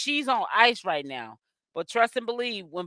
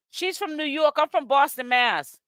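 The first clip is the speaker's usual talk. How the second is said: ordinary talk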